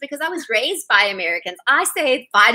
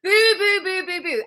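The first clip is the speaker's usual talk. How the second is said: mock booing; 7 semitones higher, slower than usual. Real, not acted. Booing a bad beauty myth.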